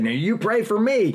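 high, loud holler answering him; hollered back. Retold or imitated. Chaplain hollering back to the prisoner, loud high call. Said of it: "I hollered back and said".

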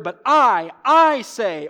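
loud, punched stress on I; emphatic I. Shown as a demonstration. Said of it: "The I is emphatic".

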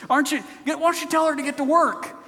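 high, fast annoyed complaint. Acted. Woman serving in the kitchen complains about her sister, annoyed. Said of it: "she gets annoyed at Mary".

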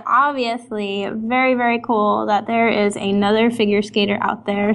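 normal talking voice

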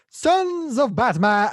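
shrieked high, loud and drawn-out battle cry; mock Batman rallying cry pitched far too high. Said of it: "Wrong pitch, bring it down".

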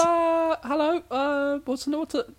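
squeaky-high British-leaning falsetto. Shown as a demonstration. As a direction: introduce yourself in a very high put-on voice. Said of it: "When I go high voice".

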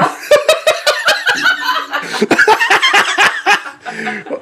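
long squealing laugh; long high laugh. Real, not acted. Cracking up at honk shoe era, long high laugh.

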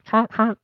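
high honking horn sound; horn honk imitation. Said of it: "a little, like, horn honk".

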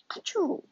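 loud sharp fake sneeze. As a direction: act out a sneeze. Narrator sneezes for George.

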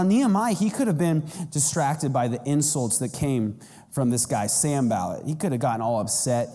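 normal talking voice

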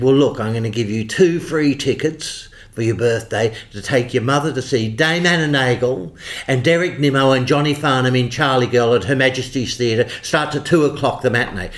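imitating the boss. Boss offering birthday tickets, booming imitated voice. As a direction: announce the gift like a booming impresario. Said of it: "So he'd speak like it".

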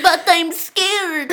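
laughing mock fear; mock scared. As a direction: whimper it like a nervous kid. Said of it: "And I was like".

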